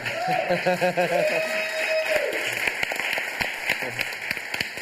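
long high cackle, trailing down; high showman laugh. Acted. Host laughs after the bang.

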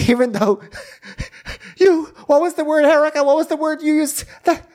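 very high, put-on faux-innocent voice; Rinna. Rinna asking Erica for the word, high caricature. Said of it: "Rinna's like".

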